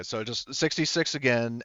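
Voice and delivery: usual speaking voice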